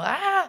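sudden high yelp of pain; sharp high yelp. Retold or imitated. Yelp as the cat's claws dig in. Cat scratches her.

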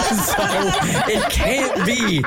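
laughing. Real, not acted. Laughing at own confusion.

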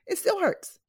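real tears, voice cracking up; tearful. Real, not acted. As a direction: voice breaking high on the words. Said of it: "why am I crying".

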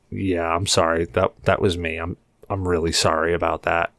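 quiet sheepish apology to the bartender. Retold or imitated. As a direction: own up meekly.

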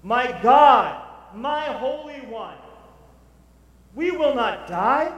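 loud, high, slow proclamation; preaching at full force. Acted. Preacher proclaiming "we will not die", loud, high and slow.

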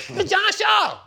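very high put-on phone greeting; John Shaw. GM announcing himself on the phone, high mimicked voice. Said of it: "That's how he talked".

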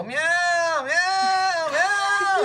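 very high, yowling cat meows; shrill-pitched mock cat distress; meowing protest. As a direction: imitate someone meowing to fend off an attack. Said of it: "she does meow".

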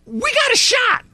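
high, mocking "we got a shot"; lightly mock the other teams' hope; mock hopeful. Mocking other teams' hopeful talk, high and sarcastic.

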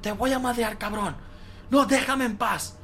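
high mock shouting in sleep; high retold yell, not at full volume; imitated yelling. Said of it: "estabas gritando".

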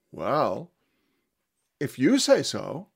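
slow, dry, knowing answer; wry reply in kind. Acted. Jesus answers Pilate's teasing in kind. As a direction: toss his teasing right back. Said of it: "answered in kind".

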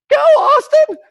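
shrieking cheer, voice jumps way up; high-pitched yell. Retold or imitated. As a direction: cheer in a shrill high squeal. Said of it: "I hit an octave that I have never hit".

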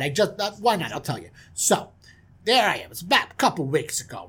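lively swaggering yarn; self-satisfied storyteller on the prowl; boastful prince. Acted. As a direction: tell the fellas your tale like a ladies' man. Prince tells his own tale.

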